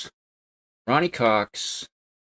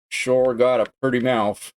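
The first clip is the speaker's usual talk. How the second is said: slow, louder Southern drawl; Southern accent; 4 semitones higher, 4 dB louder than usual. Retold or imitated. Quoting Deliverance to viewers in a Southern accent. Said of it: "I'll say it with the accent".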